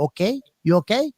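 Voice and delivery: lightly voiced attendant checking in; imitating masseuse. Masseuse checking on the client, mock polite voice. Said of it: "They go".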